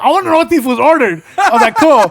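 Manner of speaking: high, loud sarcastic retelling; demanding to see the receipt; exaggerated mock indignation; indignant.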